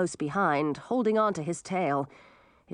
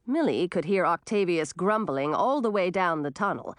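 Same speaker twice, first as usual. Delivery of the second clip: plain reading voice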